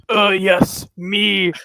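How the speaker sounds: a hint of a loud dim caveman. Retelling Aaron's caveman stream line, lightly voiced caveman. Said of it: "caveman voice saying something".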